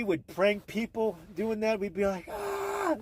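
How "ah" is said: mock scream. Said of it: "we'd scream into that thing".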